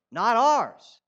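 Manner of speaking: forceful stressed "Not ours."; 「ours」を強く落として言い切る; emphatic. Acted. Preacher stresses the point.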